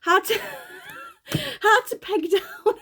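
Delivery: words squeezed out through giggles; fighting laughter, pitch jumping up; can't get it out for laughing. Real, not acted. Cracking up mid reading.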